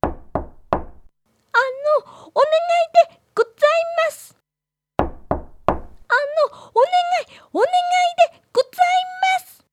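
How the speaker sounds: put-on high pleading child voice; timid girl voice. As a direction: call out shyly for help. Girl voice calling at door.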